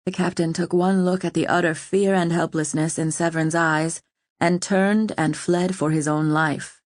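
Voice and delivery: plain reading voice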